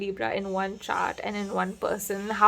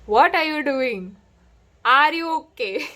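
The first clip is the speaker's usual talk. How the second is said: mock-incredulous husband impression, pitched up; playful teasing; 5 semitones higher, 6 dB louder than usual. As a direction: imitate a teasing husband, raised and louder. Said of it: "this is how he talks".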